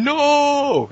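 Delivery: high, drawn-out mock cry of no; mock scream. Imitating a movie hero screaming as his face melts, mock scream.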